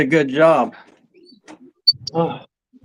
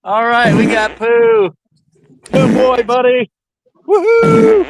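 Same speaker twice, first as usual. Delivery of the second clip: excited dog praise; 6 semitones higher, slower than usual. Real, not acted. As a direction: cheer the dog on like a proud owner. Praising dog after it pooped.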